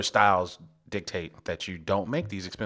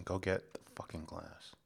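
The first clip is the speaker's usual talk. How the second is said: words pushed out through clenched teeth; through gritted teeth; 9 dB quieter than usual. Pretend. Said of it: "Don't make me talk through my teeth".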